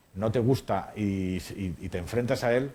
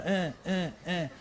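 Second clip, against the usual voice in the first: higher, limp half-hearted "eh"; mock feeble non-scream; imitating weak actor; 8 semitones higher, 5 dB quieter, much slower than usual. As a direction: mimic the actor refusing to scream. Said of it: "Toma uno, toma dos".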